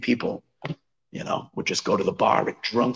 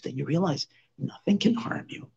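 ordinary delivery

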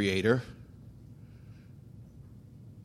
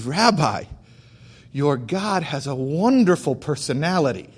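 mild retold outburst, louder on key words; excited blurting; 3 semitones higher, 3 dB louder than usual. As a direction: blurt it out like an amazed admirer. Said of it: "he blurted out ... He was so excited".

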